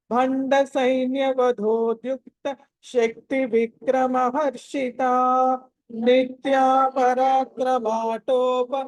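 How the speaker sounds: slow, measured Sanskrit chant; verse recitation. Shown as a demonstration. Teacher gives pupils the shloka to repeat, slow recitation. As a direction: recite slowly with drawn-out long vowels.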